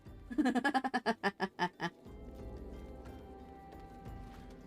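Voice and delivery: helpless run of giggles trailing off; long real laugh. Real, not acted. Streamer cracks up over the flying bed, helpless laughing. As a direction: laugh it out until it fades.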